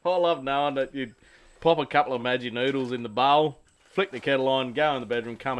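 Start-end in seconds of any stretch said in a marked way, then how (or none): 0.0-1.1 laughing. Real, not acted. Teasing friend, laughing.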